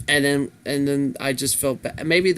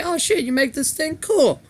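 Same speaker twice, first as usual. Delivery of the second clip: high, bright mock excitement; excited; 13 semitones higher than usual. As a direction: gush like an impressed friend. Friends reacting to his show.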